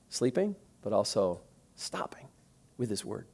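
breathy whispered aside, almost secret; hushed whisper. Acted. Preacher whispering to the congregation about Jesus. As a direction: let it drop to a whisper.

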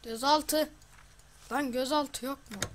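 loud, high cry of annoyance; frustrated shout. Real, not acted. Gamer shouting at the screen, frustrated and loud. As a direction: yell in frustration at the missing button. Detain button missing, frustrated.

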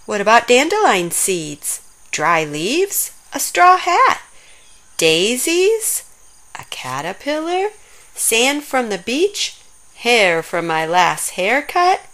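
ordinary delivery

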